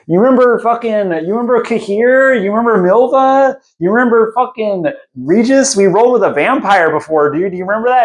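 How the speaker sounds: high-pitched, pleading mock disbelief; hammed-up urgent jogging of a friend's memory; insistent Dandelion. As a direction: pile up the reminders, voice way up. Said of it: "He's like".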